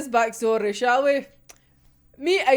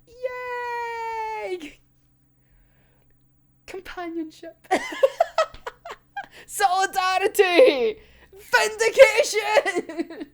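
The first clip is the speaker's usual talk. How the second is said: mock cheer; 8 semitones higher, much slower than usual. Mock celebrating allies.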